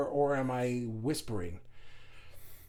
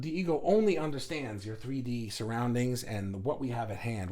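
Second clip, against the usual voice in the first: ordinary talk, nothing marked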